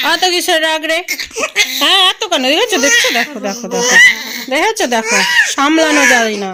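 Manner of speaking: high, loud, laughing sing-song teasing; loud laughing tease to grandma. Real, not acted. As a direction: giggle at her temper, loud and high.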